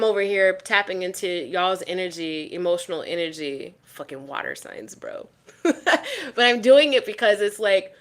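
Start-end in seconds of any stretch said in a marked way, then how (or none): 3.8-5.3 muttered aside. Real, not acted. Muttered aside about emotions. 5.6-6.3 quick high burst of laughter; short high laugh. Real, not acted. Laughs at her own aside.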